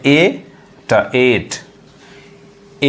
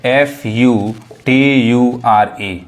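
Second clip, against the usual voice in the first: spelling out F U T U R E letter by letter, slowly; letters read out for a class; much slower than usual. Shown as a demonstration. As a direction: dictate each letter slowly. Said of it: "अब देखिए".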